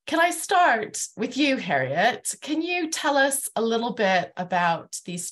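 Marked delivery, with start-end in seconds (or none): none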